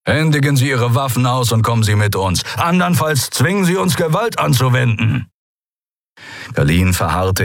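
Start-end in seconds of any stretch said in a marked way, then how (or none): 0.1-5.3 a little higher, stern command; guard captain ordering the admirals; captain's order. Acted. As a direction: demand surrender flatly. Captain threatens force.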